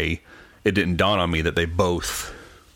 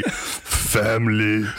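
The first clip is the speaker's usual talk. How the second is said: slow stretched "Family"; drawn-out mock fan voice; 3 semitones higher, much slower than usual. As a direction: say it slow like a meme. Mimicking fan comments.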